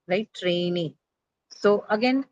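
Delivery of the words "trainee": "Trainee" with stress on the final -ee; stress on second syllable. Shown as a demonstration. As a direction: let the long E carry "Trainee". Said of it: "stress has to move on to the second".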